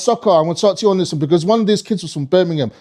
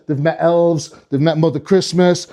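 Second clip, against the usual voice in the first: usual speaking voice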